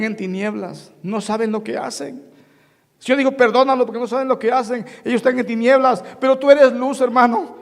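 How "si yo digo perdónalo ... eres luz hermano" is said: loud, higher sermon exhortation; fervent preaching. Acted. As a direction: press the point on the flock. Preaching climax.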